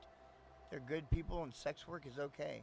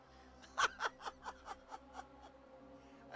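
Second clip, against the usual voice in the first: wheezing laugh. Real, not acted. Cracking up at dueling Siris.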